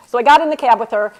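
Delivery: ordinary delivery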